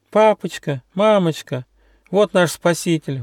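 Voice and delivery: put-on high-pitched young sheep; excited exclamation. A narrator's reading. Young sheep presents the rescuer to her parents, exclaiming. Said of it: "воскликнула Гера".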